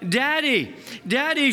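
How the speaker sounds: lightly acted child calling out in alarm; mild story scream. A narrator's reading. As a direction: call out for help, a restrained storyteller's scream. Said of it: "she screamed".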